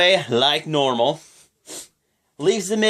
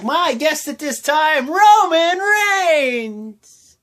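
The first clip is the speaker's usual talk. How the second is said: high, drawn-out booming introduction; ring announcer intro; 12 semitones higher, 4 dB louder, much slower than usual. Retold or imitated. Miz introducing his guest on Miz TV, grand drawn-out announcer call. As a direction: stretch the name out like a big announcement. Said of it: "So then he's like".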